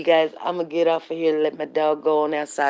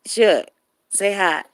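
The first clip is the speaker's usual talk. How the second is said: usual speaking voice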